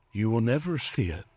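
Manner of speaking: ordinary talk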